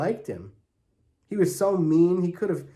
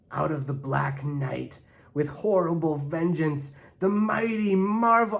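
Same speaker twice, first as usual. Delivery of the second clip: comic-book announcer; 3 semitones higher than usual. A narrator's reading. Gladys bursts into the Christmas story with comic-book drama. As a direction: ham it up like a superhero comic.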